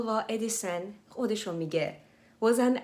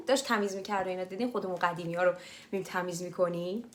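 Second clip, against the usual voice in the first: ordinary talk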